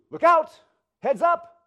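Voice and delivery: high, very loud warning yell; warning shout. Retold or imitated. Voice from behind warns of danger, yelling high. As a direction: yell to protect someone. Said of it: "protect you".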